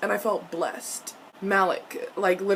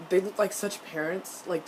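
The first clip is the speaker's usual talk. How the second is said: normal talking voice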